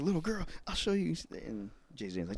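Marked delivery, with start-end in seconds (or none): 0.0-1.2 higher, fast catty threat; mock threat. Rival woman threatening a young girl, fast and higher. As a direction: snap it back. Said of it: "it's like".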